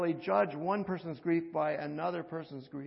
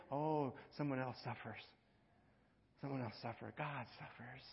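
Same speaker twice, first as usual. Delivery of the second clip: softer and softer, falling; fading to hush; 3 semitones lower, 9 dB quieter than usual. Acted. Preacher to congregation, dropping to a hush. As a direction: let each repeat sink quieter.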